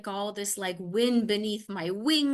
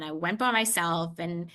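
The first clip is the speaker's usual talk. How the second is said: ordinary delivery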